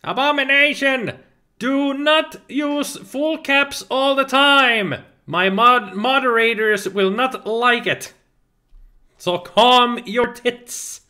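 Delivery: high, slow, drawn-out telling-off; slow exaggerated reprimand; mock scolding. Real, not acted. Streamer scolds viewer for caps.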